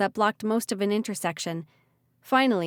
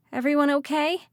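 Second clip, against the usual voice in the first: raised, higher call across the floor; 7 semitones higher, 3 dB louder than usual. Acted. Ridley calling to the others after the crash, raised voice. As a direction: call out to check on everyone. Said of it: "she called".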